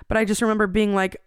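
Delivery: ordinary talk, nothing marked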